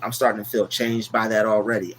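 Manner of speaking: normal talking voice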